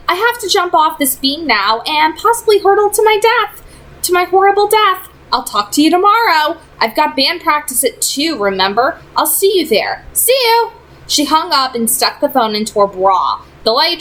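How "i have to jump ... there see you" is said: put-on lightly raised young woman's voice; a teen girl pleading with a friend; gently higher voice of an exasperated girl; Clary's voice. A narrator's reading. Clary signing off call.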